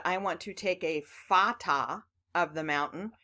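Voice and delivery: "fata" with a short a in the sentence; wrong reading. Shown as a demonstration. Teacher acting out a wrong reading. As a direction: read "fata" as a short-vowel mistake. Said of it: "but I read".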